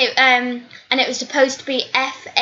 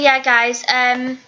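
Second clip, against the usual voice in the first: ordinary talk, nothing marked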